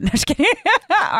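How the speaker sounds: laughing apology for teasing the soldier. Real, not acted. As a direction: giggle it out loud. Joking after teasing veteran.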